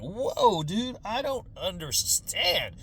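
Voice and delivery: slow, confused drawl; baffled stoner. Retold or imitated. Stoned teens staring at screaming parents, slow and baffled. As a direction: stretch it out, totally lost. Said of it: "we're like".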